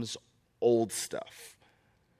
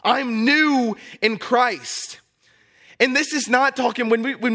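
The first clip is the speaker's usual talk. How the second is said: high, loud preacher's cry; full-force preaching; 9 semitones higher, 5 dB louder than usual. Acted. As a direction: preach it out to the whole church.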